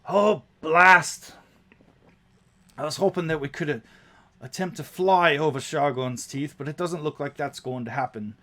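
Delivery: a captain swearing at bad luck; cursing gnome captain. Captain Biam sees the storm and curses, loud then deflated.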